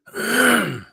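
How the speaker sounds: sharp clearing of the throat. Real, not acted. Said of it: "I don't know why I got that little".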